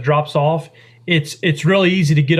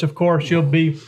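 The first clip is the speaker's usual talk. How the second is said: usual speaking voice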